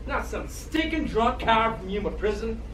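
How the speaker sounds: usual speaking voice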